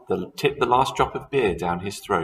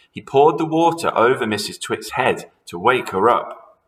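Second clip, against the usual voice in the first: ordinary delivery, nothing marked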